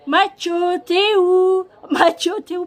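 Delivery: loud, slow, high call up the stairs. Retold or imitated. Owner calling her hiding dog Mathieu from the stairs, loud drawn-out call. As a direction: call the dog's name up the stairwell. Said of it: "puis je crie".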